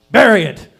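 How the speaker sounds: sudden loud order; stern command. Acted. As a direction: blurt it out hard and loud. School's harsh lesson, acted out.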